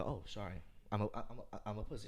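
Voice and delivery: very soft, halting apology; meek apology. Retold or imitated. Nice guy backing down from a fight, meek. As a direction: back down timidly. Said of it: "obviously I'm a nice guy".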